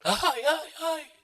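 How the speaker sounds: high mock wailing ay ay ay; imitating church women wailing; imitated wailing cry. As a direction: wail like the crowd crying out. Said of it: "escucho una gritería al frente".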